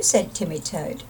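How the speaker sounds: plain reading voice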